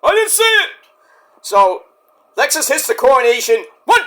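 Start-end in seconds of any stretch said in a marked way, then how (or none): 0.0-0.7 a lightly higher innocent excuse; a high, innocent mock referee; mock ref. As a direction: play dumb like the ref. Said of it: "Ref's like".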